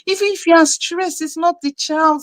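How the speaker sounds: high, emphatic retold lecture; insistent, high-pitched admonishing; exasperated scolding. Scolding the father to calm down.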